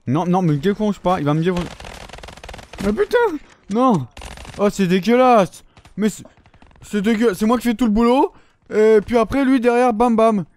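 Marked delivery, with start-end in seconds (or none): 2.8-4.1 frustrated gamer yelp; exasperated outburst. Real, not acted. Outraged at teammate in game. 4.6-10.4 higher and louder, complaining hotly; a gamer's indignant moan; indignant rant. Real, not acted. Ranting about a stolen kill.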